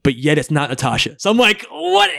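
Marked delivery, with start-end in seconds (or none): 1.7-2.1 surprised. Retold or imitated. Incredulous reaction in retold thought.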